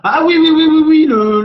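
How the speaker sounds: high, loud eager yes yes; excited recognition. Real, not acted. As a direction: burst out as it clicks. Recognizing the actor.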